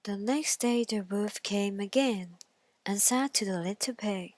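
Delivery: usual speaking voice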